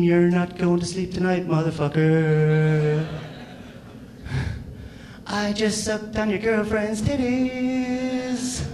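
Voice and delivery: slow, sing-song taunting mosquito; mocking mosquito singing; mosquito with American accent. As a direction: croon it like a smug insect. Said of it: "the mosquito has an American accent".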